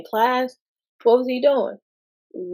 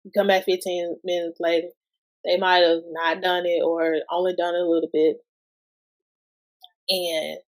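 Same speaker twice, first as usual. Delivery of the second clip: ordinary talk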